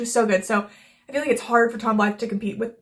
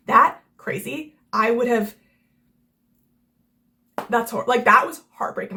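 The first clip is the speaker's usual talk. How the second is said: ordinary talk, nothing marked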